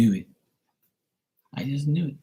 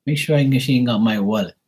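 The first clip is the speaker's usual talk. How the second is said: indignant; 8 semitones higher than usual. Retold or imitated. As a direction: react like you just caught her sneaking off. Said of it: "I'll be like".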